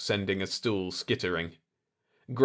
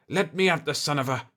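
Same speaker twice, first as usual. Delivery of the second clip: usual speaking voice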